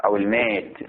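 deliberate classical recitation, phrase by phrase; measured reading from a legal text; formal text reading. Acted. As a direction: read aloud to a class, stretching the endings. Reads the book text aloud.

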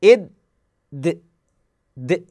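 إد then د released with an open echo; bounced d sound. Shown as a demonstration. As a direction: let the د bounce as the tongue opens. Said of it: "بالدال". (قلقلة الدال بانفتاح)

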